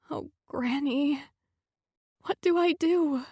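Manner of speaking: high, breaking plea to her late granny; tearful plea. Acted. Alone on the floor, begging her late granny, tearful. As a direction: cry out to Granny through tears.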